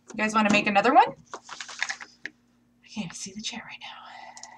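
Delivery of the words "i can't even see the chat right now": slow whisper to herself; whispered aside. Real, not acted. Streamer who can't see the chat, whispering. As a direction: murmur it off-mic.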